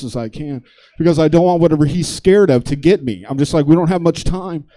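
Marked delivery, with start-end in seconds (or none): 3.7-4.6 higher, urgent mock alarm; mock panic. Comic at the urinal warning himself, mock panic. Imagines fleeing an unknown danger.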